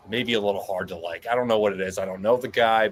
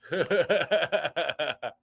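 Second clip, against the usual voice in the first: bright burst of laughter; high laugh; 6 semitones higher than usual. Real, not acted. Cohost laughing at the win, a high laugh.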